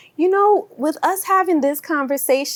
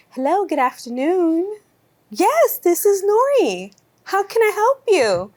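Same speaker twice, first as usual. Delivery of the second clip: polite phone voice; 7 semitones higher than usual. Retold or imitated. Answering an important call, switching to a prim, high phone voice. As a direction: put on the prim phone manner for the caller. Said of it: "her voice got so, so feminine".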